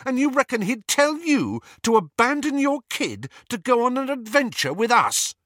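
high, loud, furious accusation; rage. A narrator's reading. Harry accusing Lupin of abandoning his kid, enraged. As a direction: let the anger climb as you accuse him. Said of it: "did not know where his rage was coming from".

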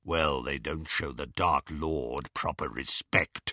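low, soft werewolf voice; menacing werewolf. A narrator's reading. Werewolf explaining taboo to captives, low menace. Greyback's voice.

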